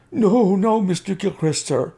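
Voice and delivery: high anxious cry of denial; desperate cry. A narrator's reading. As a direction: servant crying out his innocence to the student. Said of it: "cried the servant".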